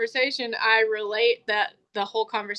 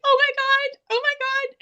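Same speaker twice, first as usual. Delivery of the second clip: squeaky-high mock panic; high-pitched distraught act. Bounty hunter faking a distraught girlfriend, high-pitched. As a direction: imitate an upset woman far up in pitch. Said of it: "she's been talking literally like".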